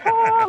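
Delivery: high, sustained choir-like note; heavenly "ah". Retold or imitated. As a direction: imitate the sound of a heavenly light shining. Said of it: "like it's going to heaven".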